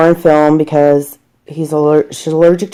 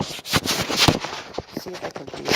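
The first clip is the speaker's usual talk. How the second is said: hushed concentration; 12 dB quieter, much slower than usual. Real, not acted. Concentrating on clipping nails.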